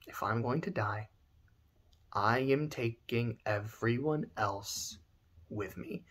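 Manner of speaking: low, soft threat fading to a whisper; menacing whisper. Acted. Kuja vowing to take everyone with him, low whisper. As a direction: hiss the threat low and quiet.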